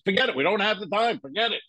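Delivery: high, hurried dismissal; imitating someone giving up on you; retold brush-off. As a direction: wave it off as the impatient producer. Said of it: "he goes".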